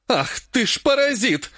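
high, drawn-out outraged exclamation; indignant outburst. A narrator's reading. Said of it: "возмутилась она".

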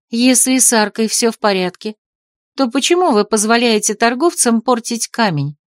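usual speaking voice

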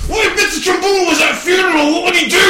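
high, loud, exasperated question; yelled prompt. Pretend. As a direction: shout the scenario at the actor. Host yells prompt at actor.

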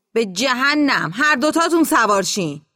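exasperated holler from the tractor; exasperated shout. Acted. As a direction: give in loudly, stretching the first words. Driver gives in, shouting.

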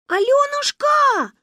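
very high, slow, loud sing-song call; thin voice call. A narrator's reading. Manya calls the girl in a thin high voice. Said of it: "тоненьким голосом позвала Маня".

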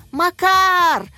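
excited screaming. A narrator's reading. Teacher cheering her pupils on, screaming. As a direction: scream each word, stretching it out. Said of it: "кричала Екатерина Михайловна".

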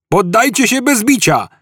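high-pitched police demand; calling out. A narrator's reading. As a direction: call on the fugitives to surrender. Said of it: "zawołał jeden z gliniarzy". (zawołanie wysokim głosem)

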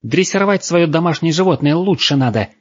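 high-pitched indignant telling-off; shrieky, outraged reprimand; angry girl scolding. A narrator's reading. Squealing girl scolds owner.

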